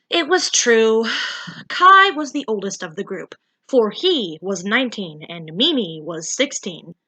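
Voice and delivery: ordinary delivery, nothing marked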